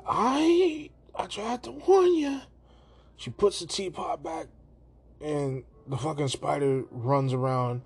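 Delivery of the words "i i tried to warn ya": high, drawn-out singsong put-on voice; hammed-up high character voice; Mulan. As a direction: sing out a mock warning, high and louder. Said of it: "so Mulan's like".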